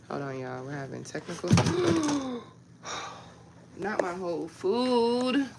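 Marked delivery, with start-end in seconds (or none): 1.5-2.4 high shocked gasp. Real, not acted. Gasp as her food falls while live. 3.8-5.5 slow, higher wail of dismay; drawn-out lament over lost food. Real, not acted. Lamenting dropped food to viewers, slow and dismayed.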